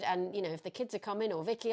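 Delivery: ordinary talk, nothing marked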